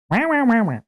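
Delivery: high, loud mocking wah wah; mock blather. Imagined manager's high, loud gibberish. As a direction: brush off the gibberish they'll say. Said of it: "they'll be like".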